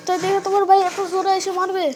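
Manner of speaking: ordinary talk, nothing marked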